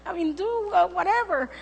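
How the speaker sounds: high, hedging mother's suggestion; placating mother. Retold or imitated. Mother tells daughter to just tidy up, placating. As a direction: talk her down, pitched high.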